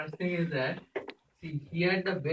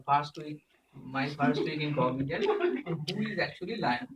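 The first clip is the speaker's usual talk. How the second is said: usual speaking voice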